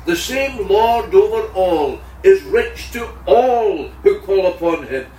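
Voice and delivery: ordinary talk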